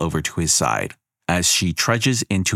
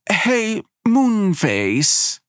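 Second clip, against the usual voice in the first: much higher call across the crowd; much slower than usual. A narrator's reading. Goddess calls out to altar boy across crowd. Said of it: "She calls to him".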